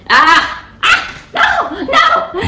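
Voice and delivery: squealing while being tickled. Real, not acted. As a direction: shriek and protest under tickling. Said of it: "I hate being ticklish".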